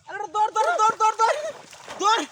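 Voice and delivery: loud, urgent calls to run; husband yelling at his wife to run; calling out. Acted. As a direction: shout run, run. Urging her to run.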